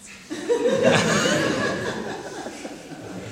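risatina sadica molto alta; risatina acuta e sadica. Acted. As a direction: ridacchia acuto come un cattivo. Selezionatore ride sadico. (risatina acuta e maligna del selezionatore)

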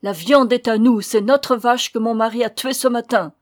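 loud, indignant claim; acted fierce insistence; defiant tone. A narrator's reading. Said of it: "Son ton, comme son attitude, surprit".

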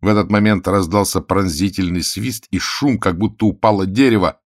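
ordinary delivery

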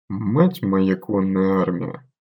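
slow, soft stunned exhale; breathed out in shock. Acted. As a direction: breathe it out in disbelief. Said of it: "выдохнул наёмник".

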